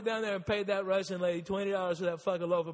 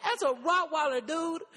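high, loud mock-panicked self; a high, loud cry of helpless panic; 10 semitones higher, 3 dB louder than usual. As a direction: squeal the excuse way up high. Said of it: "And I'm like".

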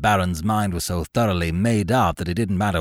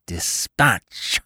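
gnashing teeth. A narrator's reading. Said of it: "cried the figure, gnashing his teeth".

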